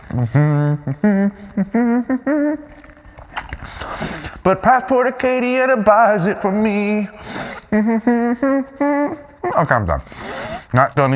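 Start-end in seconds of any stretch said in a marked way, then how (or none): 0.1-9.6 rising mm-hmm chant. Real, not acted.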